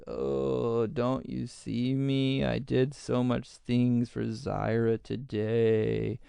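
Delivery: slow, soft, droopy self-pitying whine; melting whine. Pretend. Showing how to melt into the victim role, slow droopy whine. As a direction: ooze the words out like you're melting. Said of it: "as if we're just melting and oozing".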